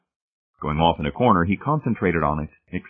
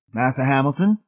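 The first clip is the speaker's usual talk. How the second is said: mocking burlesque of Southern dialect, higher; higher-pitched, burlesqued dialect; 7 semitones higher than usual. A narrator's reading. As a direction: put on a mock-servile Southern dialect. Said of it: "Laws burlesqued".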